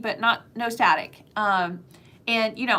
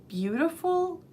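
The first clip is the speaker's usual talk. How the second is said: a hesitant "beautiful." like a question; 7 semitones higher, 3 dB quieter, slower than usual. Real, not acted. Talking about Arizona, a doubtful "beautiful." like a question. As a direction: say "beautiful." with a doubtful upward lilt. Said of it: "I say that as a question mark".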